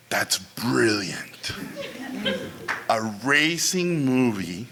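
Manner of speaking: very slow, low, weighty pronouncement; hushed, drawn-out mock reverence; awed studio president. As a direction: pause between phrases as if struck by genius. Studio president awed at accidental idea.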